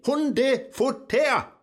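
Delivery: high, loud and slow, spelling it out; exasperated shout. Acted. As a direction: bellow the word at the deaf machine. Assistant keeps mishearing.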